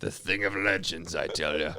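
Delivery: low, hushed mock-ominous voice; legend-telling trucker. Trucker recounting legends of the north, mock-ominous. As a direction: intone it low like old frontier lore.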